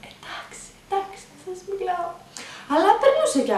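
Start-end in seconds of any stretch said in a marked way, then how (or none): 0.1-2.1 barely whispered, drained by pain; weak whisper. Retold or imitated. Woman in labour whispering weakly to the midwives. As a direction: breathe the words out, no strength left.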